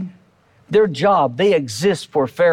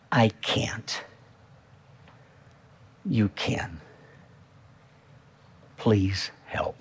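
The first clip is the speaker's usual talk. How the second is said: softer, near-whispered plea to God; hushed prayer; 8 semitones lower, 8 dB quieter, much slower than usual. Acted. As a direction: breathe the words out as a private prayer.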